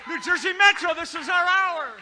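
loud rallying preacher cry; roaring rallying speech. Acted. Rallying the region.

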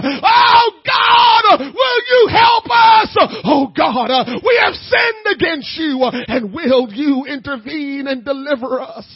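loud, high pleading shout, voice near breaking; crying prayer. Acted. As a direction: cry out to God with everything. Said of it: "begin to cry, and sigh".

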